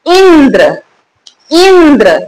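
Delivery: "Indra" with the opening इ leaned on, then falling; stress on first vowel. Shown as a demonstration. Teacher showing where stress falls, stressed "Indra". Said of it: "यहाँ पे जोर कहाँ है? ई पर है".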